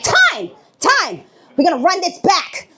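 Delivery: high, urgent coach call; hammed-up sideline coach; mock coach timeout call. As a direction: call the timeout like it's the finals. Calling timeout at the waitress.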